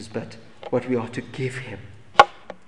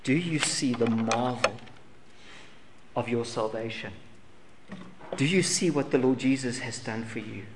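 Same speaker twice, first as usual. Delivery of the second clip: ordinary talk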